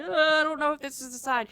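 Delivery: high, nervous muttering to herself; a frazzled cartoon wrapper, unsure of the side; nervous, unsure self. Stressed wrapper second-guessing her work, high and unsure. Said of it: "I was just like".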